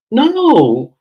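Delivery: high, loud put-on wail; mock whiny refusal. Imitating Brittany asked if he should leave, mock whine. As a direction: imitate a weepy, drawn-out refusal. Mimics tearful Brittany's answer.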